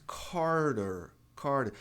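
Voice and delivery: "Carter" with the T flapped, like "karder"; "Carter" said the American way, the T tapped. Shown as a demonstration. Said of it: "Em inglês".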